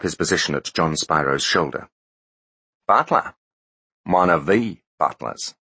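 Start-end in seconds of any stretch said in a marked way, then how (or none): 2.9-5.5 lightly voiced New Zealand drawl; a hint of New Zealand drawl, taunting. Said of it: "in the New Zealand drawl".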